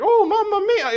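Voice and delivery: high, loud hammed-up Italian accent; mock Italian accent to make fun of it. Mocking how Mario sounds in a hammed-up Italian accent. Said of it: "no one sounds like".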